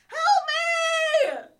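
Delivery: piercing high shout; screaming for help. Acted. Boy fleeing the beast screaming for help, piercing shout. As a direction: shriek help me at the top of your lungs. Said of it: "I screamed".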